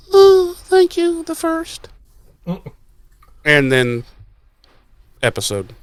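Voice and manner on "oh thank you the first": very high, slow, simpering mock voice. Viewer mocking Wood's sudden meekness, high simpering. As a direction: drag it out high like a fawning pushover. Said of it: "Where did we go from".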